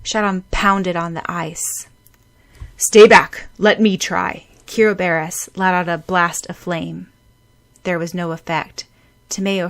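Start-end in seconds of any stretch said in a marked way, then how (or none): 2.8-4.5 loud urgent command; firm order. Acted. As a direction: order them back firmly. Taking over.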